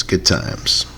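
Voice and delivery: "good times" breathed out in a whisper. Shown as a demonstration. Host reminiscing to listeners in a wistful whisper.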